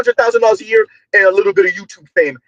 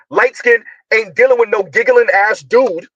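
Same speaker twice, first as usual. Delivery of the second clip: normal talking voice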